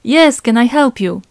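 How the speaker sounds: loud, high, drawn-out reply; insistent calling out. Acted. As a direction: stretch it out and call across. Rose insists, calling to the stranger.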